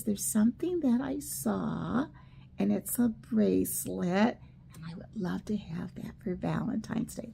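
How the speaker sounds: retold request in a whisper; grandma whispering her secret wish to grandpa; very quiet voice. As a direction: whisper it as grandma asking shyly. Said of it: "she told him in a very quiet voice".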